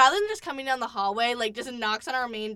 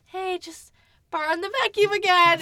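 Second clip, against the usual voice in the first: higher, brightly casual announcement; breezy cheerful; 8 semitones higher, 6 dB louder than usual. Retold or imitated. Telling Ethan's friends she's taking the vacuum, breezy. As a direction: breeze in like it's nothing. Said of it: "and I'm like".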